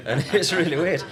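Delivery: loud, high, laughing. Real, not acted. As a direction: laugh through the words. Laughing at his own monotone.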